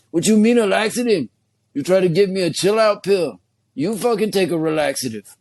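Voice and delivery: comic Mexican-American vato, mock outrage; indignant vato. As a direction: push back like an offended homeboy vato. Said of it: "now I'm gonna go full-blown vato".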